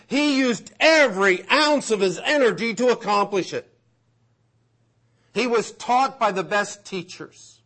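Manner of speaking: high, emphatic pulpit delivery; impassioned preaching. Acted. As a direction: preach it with driving conviction. Preaching with rising force.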